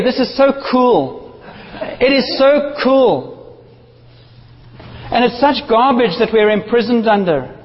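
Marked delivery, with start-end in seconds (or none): 0.0-3.6 drawn-out "so cool"; slow emphatic. Real, not acted. Preacher insisting to the congregation, slowly. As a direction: savour every word slowly. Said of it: "No, really".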